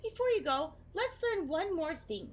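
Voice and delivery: friendly teacher voice. Acted. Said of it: "said Edna".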